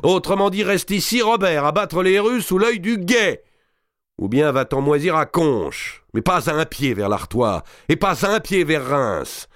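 a hint of pompous officer; mocking the captain. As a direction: ape the petty orders with scorn. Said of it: "imitant le capitaine du Châtelet".

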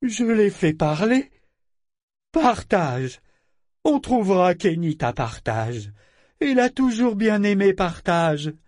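put-on high, loud child's voice; frightened, defiant little boy. A narrator's reading. Figurehead defending his killing, scared defiant little boy. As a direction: protest like a scared boy daring you. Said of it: "d'une voix effrayée et provocante de petit garçon".

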